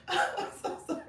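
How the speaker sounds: high, voiceless apology through real laughter; helpless laughter. Real, not acted. Host apologizing to her viewers, helpless with laughter. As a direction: squeeze the words out mid-fit.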